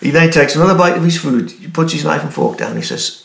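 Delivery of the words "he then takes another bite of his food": ordinary talk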